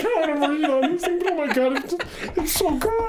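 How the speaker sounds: high, laughing, breathless awe; giddy awe. Retold or imitated. Teen gushing to himself over the concert grand, high and laughing. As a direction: gush over the piano through laughter.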